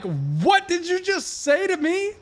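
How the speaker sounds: high, loud, slow Southern "what did you just say to me"; Southern mock challenge. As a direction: drawl it like picking a fight. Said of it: "In my mind".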